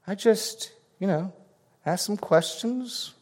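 high, slow, wide-eyed innocent voice; mock innocent denial. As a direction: fake innocence with a rising I never. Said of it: "leaving ourselves plenty of plausible deniability".

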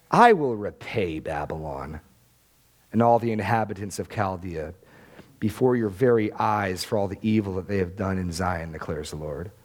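low, solemn scripture reading; solemn divine vow. Acted. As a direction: drop low and pronounce God's judgement. Reads God's vow of judgement.

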